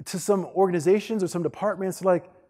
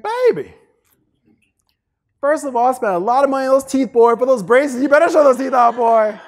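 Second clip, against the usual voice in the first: loud, high mom voice; scolding mama; 10 semitones higher, 8 dB louder than usual. Retold or imitated. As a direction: scold your grown son, high and loud. Voicing his mother scolding him.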